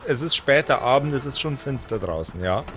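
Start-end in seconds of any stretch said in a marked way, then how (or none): none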